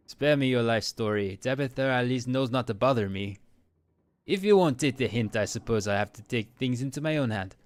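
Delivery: bad French accent, haughty and irritated; Frou Frou, French accent. Cat rebuffing questions about a cousin, terrible French accent. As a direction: dismiss them in a hammy French accent. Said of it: "for my terrible accent".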